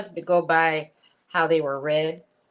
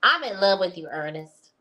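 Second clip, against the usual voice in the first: louder, emphatic woman's impression; punched-up reading in her character; 2 semitones higher, 3 dB louder than usual. Shown as a demonstration. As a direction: say it as the lovestruck woman would. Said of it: "I said that in my voice, but this is".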